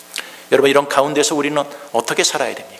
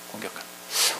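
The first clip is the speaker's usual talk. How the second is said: whispered echo; 4 semitones lower, 12 dB quieter than usual. Shown as a demonstration. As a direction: repeat it in a bare whisper. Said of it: "echo repeat". (속삭이는 반복)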